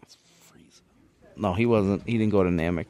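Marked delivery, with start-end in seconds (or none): 0.0-0.8 whispered name; breathy whisper of Frieza. Real, not acted.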